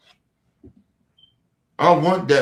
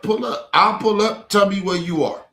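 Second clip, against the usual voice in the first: angry dare; 6 semitones higher, 4 dB louder than usual. Real, not acted. Daring the viewer to come.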